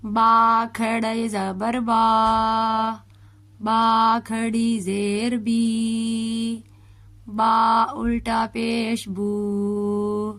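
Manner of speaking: put-on slow, drawn-out syllable spelling for a child to repeat; slow spelling drill. Shown as a demonstration. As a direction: spell each vowel mark out slowly. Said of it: "میرے ساتھ اس کی practice کرتے ہیں". (آہستہ آہستہ ہجے کرنا)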